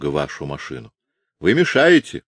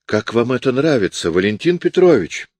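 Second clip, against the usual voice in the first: exclaiming; 6 semitones higher than usual. A narrator's reading. Fake navigator appealing to Valentin Petrovich, crying out. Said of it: "вскричал же штурман".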